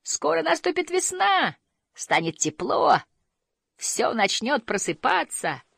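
high-pitched, elated calling; excited high cries, drawn out toward the end; jubilant crying out. A narrator's reading. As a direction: proclaim it joyfully to everyone. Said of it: "продолжал кричать мумитроль".

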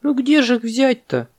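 very high, squeaky puzzled monkey; surprised. A narrator's reading. Monkey wonders where to get bananas, surprised. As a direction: ask in high-pitched bewilderment. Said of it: "удивилась мартышка".

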